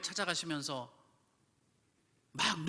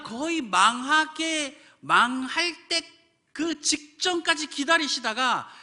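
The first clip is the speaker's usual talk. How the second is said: slow, much higher, loud preaching cry; preaching at full force; 8 semitones higher, 5 dB louder, slower than usual. Acted. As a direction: preach it out, stretching every word. 설교를 힘껏 외침. (힘차게 외치는 설교)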